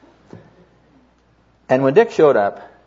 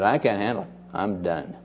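retold resignation; 4 semitones lower, 8 dB quieter than usual. Burned-out friend admits he is done, mildly resigned. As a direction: voice sinking lower and softer.

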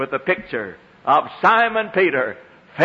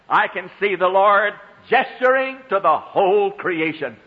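ordinary delivery

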